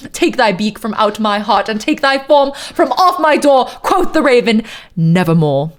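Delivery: dramatic posh British declamation; fake posh British accent. Narrator banishing the raven from his door, fake posh British accent. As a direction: order the bird out in theatrical fake British. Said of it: "my fake ass posh British accent".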